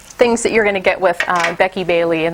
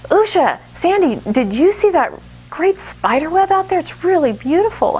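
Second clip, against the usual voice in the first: imitating a child, much higher and eager; a kid bursting in with news, pitched high; excited student; 9 semitones higher than usual. Student excitedly reports spider web.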